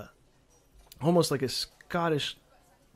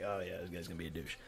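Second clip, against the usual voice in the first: knowing; 9 semitones lower, 15 dB quieter, faster than usual. Retold or imitated.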